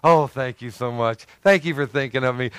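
overly polite gift thanks; mock gracious. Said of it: "you're so gracious".

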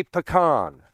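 "pecan" as puh-KAHN; puh-KAHN pronunciation. Shown as a demonstration. Preacher testing exceptions to the vote, "pecan" as puh-KAHN. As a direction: say "pecan" with a broad ah ending. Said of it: "that you would say pecan".

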